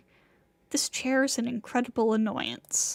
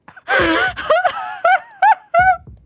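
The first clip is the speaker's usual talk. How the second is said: loud, very high manic cackle; shrieking unhinged laugh; manic outburst; 12 dB louder than usual. A narrator's reading. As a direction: cackle like you've snapped. Acted frenzy while smashing the chair.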